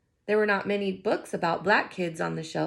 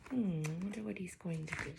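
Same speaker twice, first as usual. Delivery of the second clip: soft, quick wondering aside to listeners; think-aloud aside; 12 dB quieter, faster than usual. Acted. Teacher wondering aloud to young listeners, softly. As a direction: muse softly to the children.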